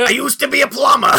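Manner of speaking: high squeaky hero voice; squeaky put-on voice. Host does a hero's plumber line, squeaky and high. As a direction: squeak it out like a cartoon.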